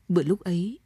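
ordinary delivery, nothing marked

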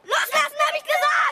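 laut und hoch gegen die Angreifer schreien; wütender Schrei. Acted. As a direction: sich mit aller Kraft losreißen wollen. Junge wehrt sich gegen Ganoven. (wütend schreiender Junge)

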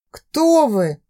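usual speaking voice

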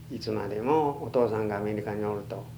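normal talking voice

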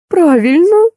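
high-pitched, puzzled reply; surprised. A narrator's reading. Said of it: "удивлённо посмотрела на меня". (удивлённо, высоким голосом)

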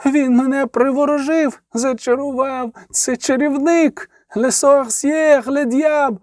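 high-pitched, increasingly drawn-out acted wail. A narrator's reading. Accused sister blaming her bewitched seduction, wailing. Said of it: "завила Аделя фон Стерча".

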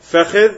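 teacher listing the four forms; pronunciation demo. Shown as a demonstration. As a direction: announce the first form فخذ with emphasis. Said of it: "أربع لغات".

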